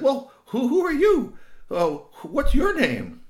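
high, loud owlet voice; baby owl. Acted. Little owl asks the stranger's name, high and loud. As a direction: stammer out a high, hooting question.

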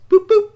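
three high, loud beeps; bright mechanical boops; high button beeps. Acted. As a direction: beep like tiny buttons. Robot pressing two buttons.

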